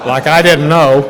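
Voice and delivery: a rueful laugh at himself; real laugh breaking into the line. Real, not acted. Laughs at own story.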